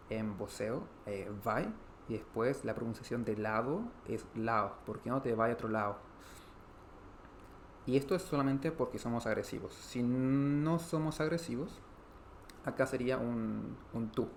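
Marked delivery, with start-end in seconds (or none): none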